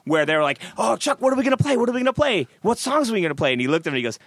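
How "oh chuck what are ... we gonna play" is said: anxious musicians. Retold or imitated. Nervous band asking Chuck.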